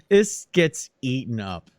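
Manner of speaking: ordinary talk, nothing marked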